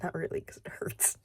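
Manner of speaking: whispered aside; whispering. Real, not acted. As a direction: breathe it out under your breath. Aside after sarcasm.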